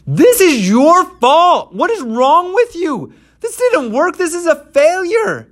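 shrill, very high accusing shout; angry crowd. Acted. The beaten people accusing Moses, high and shouting. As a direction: blame him at the top of your voice.

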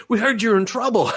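high, loud eager rescuers; eager crowd. Retold or imitated. Boys rushing to rescue the girl, eager high call. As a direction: chime in like a crowd rushing to help.

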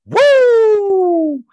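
high, very loud wrestler woo; Ric Flair woo. Acted. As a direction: let out a wild wrestling woo. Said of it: "that Ric Flair woo".

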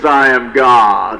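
low, slow, stately God voice; big voice of God. Acted. God reveals himself to hanging man, big slow voice. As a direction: announce it deep and drawn out. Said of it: "there was this big voice".

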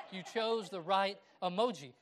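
chuckling at the joke; amused laugh while speaking. Real, not acted. Laughing at own story.

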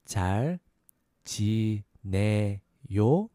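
잘 지내요 slowly, syllables apart, rising at the end; slow syllable breakdown. Shown as a demonstration. Teacher breaking down "how are you", slow syllables. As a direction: space out 잘 지내요 as a question. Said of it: "A little faster".

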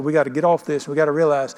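ordinary talk, nothing marked

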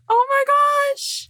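high, slow exclamation of shock. Real, not acted. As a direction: gasp it out in amazement. Reacting to donkey and dragon.

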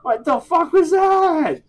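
high, slow, loud disbelief; incredulous. Real, not acted. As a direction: stretch out the disbelief. Repeated louder, slower.

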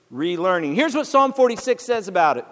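ordinary talk, nothing marked

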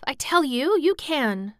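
high, insistent murmur of encouragement; will him on fervently; eager, private insistence; urging to herself. A narrator's reading. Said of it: "said Mary to herself".

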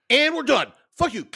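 high-pitched sign-off into sudden swearing; imitated vocal tic. Retelling the end of her makeup video, imitated tic. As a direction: imitate finishing up, then an abrupt tic. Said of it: "five hundred ticks or something like that".

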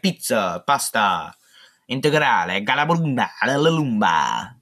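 slow, hammed-up fake Italian; mock Italian. Host joking about how Italian sounds, mock Italian. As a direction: roll it like a cartoon Italian.

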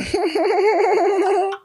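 high squealing held-back laugh; stifled giggles. Real, not acted. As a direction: try not to laugh too hard. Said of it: "trying not to laugh too hard".

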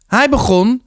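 high, indignant protest. A narrator's reading. Martin to the rector, protesting. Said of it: "protesteerde Martin".

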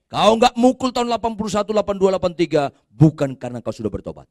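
loud, high rebuke voiced as God; thundering preaching. Preaches at full force, God's reply.

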